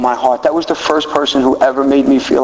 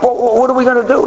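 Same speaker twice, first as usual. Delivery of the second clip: high, fast, stammering panic; flustered; 9 semitones higher, faster than usual. Retold or imitated. To his sponsor, high and rushed in flustered panic. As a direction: sputter it out, caught off guard.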